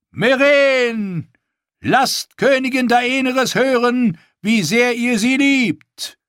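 high, loud, slow royal proclamation; booming ceremonial announcement to crowd; proclaiming king. A narrator's reading. King addresses arena crowd.

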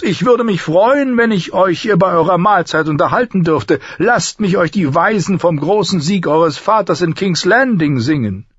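high, florid minstrel voice; eager flattering singer. Acted. Singer offers to entertain the lord, high and eager. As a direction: offer your songs with high-pitched flourish.

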